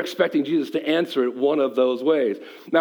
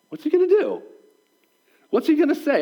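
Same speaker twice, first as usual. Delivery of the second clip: high-pitched, wondering crowd thoughts; pitched up, as an eager onlooker; onlookers' suspense; 9 semitones higher than usual. Acted. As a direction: act out a crowd on tenterhooks. Voicing the onlookers' anxious suspense.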